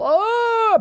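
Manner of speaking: high drawn-out "oh"; long, high mock whoop; mock battle cry. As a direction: let out a high, held "oh". Acting out the castle storming.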